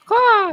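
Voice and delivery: high loud crow caw; bird call caw. Acted. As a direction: squawk like a crow signal. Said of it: "I signal my two brothers".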